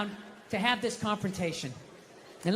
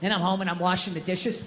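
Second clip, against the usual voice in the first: ordinary talk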